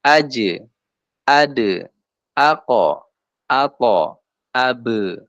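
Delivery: "Aj, ade, ako, ato, abe" stopped then bounced; bouncing letters. Shown as a demonstration. Teacher illustrates qalqalah, "Aj, ade, ako, ato, abe" bouncing. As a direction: give each final consonant a short rebound. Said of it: "maka dia memantul".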